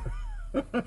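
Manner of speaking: silent laugh. Real, not acted. Laughing mid-sentence.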